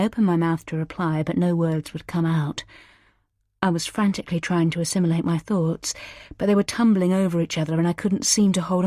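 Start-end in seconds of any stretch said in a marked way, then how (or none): none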